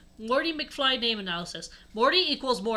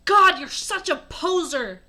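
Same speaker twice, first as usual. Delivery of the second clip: loud, higher hammed-up shout; shouted insult; 6 semitones higher, 6 dB louder than usual. A narrator's reading. Narrator shouts at Hagrid, scornful shout. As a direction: yell it in mock disgust. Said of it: "I shooted at Hagrid".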